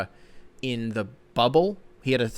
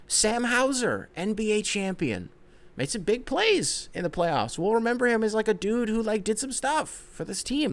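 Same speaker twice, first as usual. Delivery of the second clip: champion roll call; 10 semitones higher than usual. Pretend. Host testing how the champion title feels, high-pitched awe. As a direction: proclaim it high, stretching the words out. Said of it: "reading each name and saying".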